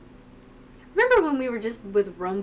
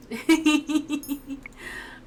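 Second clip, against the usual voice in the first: high, rapid giggle; laugh; 7 semitones higher than usual. Real, not acted. Reacting to the sad game scene, laughing.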